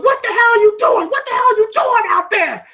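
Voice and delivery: high-pitched, exasperated mock yell; pissed. Apollo scolding Rocky in the corner, pissed. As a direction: imitate an angry cornerman. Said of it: "Apollo's pissed".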